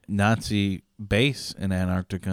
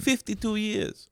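put-on slow, high, drawn-out Italian accent; mock Italian accent; 12 semitones higher, 5 dB quieter, slower than usual. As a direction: stretch it out like a hammy Italian. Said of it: "doing an Italian accent".

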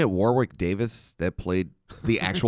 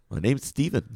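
lightly put-on Indian accent, pitched up; 5 semitones higher than usual. Fake tech support agent giving his name, Indian accent. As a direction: mimic an Indian call-centre agent. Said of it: "he didn't have that accent".

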